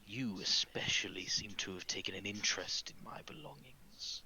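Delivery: hushed, menacing villain voice; pointed villain. Acted. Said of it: "and said".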